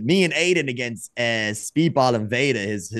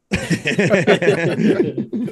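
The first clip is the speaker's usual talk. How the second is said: long, loud burst of laughter; 5 semitones higher, 5 dB louder than usual. Real, not acted. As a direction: laugh hard after teasing a friend. Laughs after joking insult about colleague.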